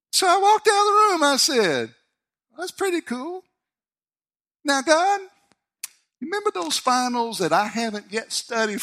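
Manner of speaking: bubbling over with relief and joy; elated. Retold or imitated. Retold elated prayer after good news.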